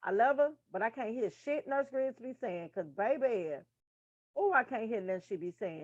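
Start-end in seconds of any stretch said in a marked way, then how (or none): none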